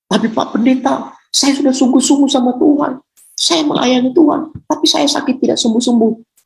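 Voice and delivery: high-pitched pleading complaint; complaining church member. Acted. Sick church member protests to the pastor, high-pitched complaint.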